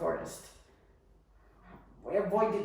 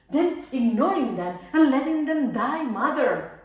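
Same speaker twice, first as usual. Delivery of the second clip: raised, higher voice in impassioned protest; indignant outburst; 4 semitones higher, 7 dB louder than usual. Acted. Daughter pleading with mother over the government's cruelty, indignant. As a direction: cry out the injustice, louder and higher.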